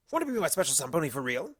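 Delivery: rushed fast question; quickly. Acted. Discord asks Pinkie out, blurted out fast. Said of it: "he suggested quickly".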